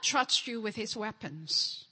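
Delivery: ordinary delivery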